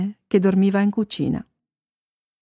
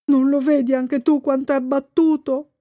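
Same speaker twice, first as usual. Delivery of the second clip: uncle fish; 5 semitones higher than usual. A narrator's reading. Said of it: "le diede manforte lo zio".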